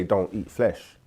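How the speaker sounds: ordinary talk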